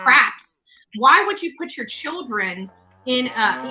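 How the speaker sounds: ordinary talk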